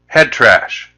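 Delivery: ordinary talk, nothing marked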